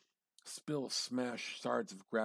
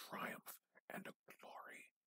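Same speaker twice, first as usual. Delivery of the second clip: hushed whisper of triumph; 11 dB quieter than usual. A narrator's reading. Narrator reading to listeners, whispering triumph. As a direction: breathe the words out, awed.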